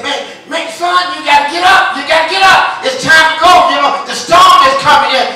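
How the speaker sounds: high, loud, frantic yelling; frantic shout. Retold or imitated. Third man yelling at the boy as the storm nears. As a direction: scream at the boy to move. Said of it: "Another man said".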